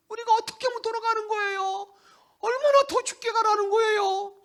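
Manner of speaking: high, loud complaining retort; arguing people. Acted. The people arguing with God, high and aggrieved. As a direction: demand an answer, aggrieved. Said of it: "이렇게 따지잖아요".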